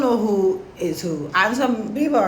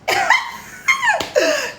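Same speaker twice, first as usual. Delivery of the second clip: squealing high laugh; real laughter at the story. Real, not acted. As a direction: let a high laugh burst out. Amused by story.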